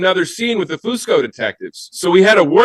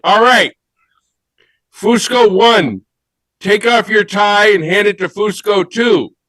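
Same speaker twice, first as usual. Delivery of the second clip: raised, deliberate director's voice; higher-pitched mock-official commands to the cast; director calling orders; 5 semitones higher than usual. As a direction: call out each instruction like a film director. Acts out director ordering tie swaps.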